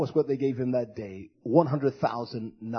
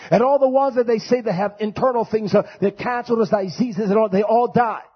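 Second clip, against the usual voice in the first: higher and louder, building the sermon; rising preacher voice; 7 semitones higher, 5 dB louder than usual. Real, not acted. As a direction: preach it, pushing harder each line. Preaching building to full force.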